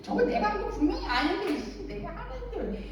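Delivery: a touch of retold annoyance; irritated muttering. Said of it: "신경질이 나가지고".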